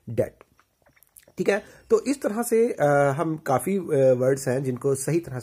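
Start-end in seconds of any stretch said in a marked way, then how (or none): 0.1-0.3 डेड as "det" with silent b; correct pronunciation. Shown as a demonstration. Teacher confirming the silent b, correct pronunciation. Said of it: "बी silent हो जाएगा".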